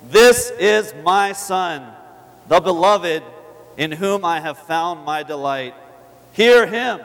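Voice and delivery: slow, loud, ringing voice of God; solemn proclamation. Acted. Reading God's voice from scripture.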